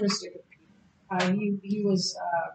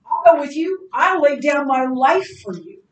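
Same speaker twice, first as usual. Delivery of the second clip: louder, higher eager vow; zealous vow; 5 semitones higher, 10 dB louder than usual. Retold or imitated. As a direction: promise it with burning zeal, loud. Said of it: "and then he says".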